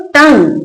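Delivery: टंग said as "tung", ending on ng; silent U. Shown as a demonstration. Teacher answering how to read it, टंग with silent letters. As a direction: model टंग as one syllable, silent ending. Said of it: "क्या पढ़ेंगे इसको".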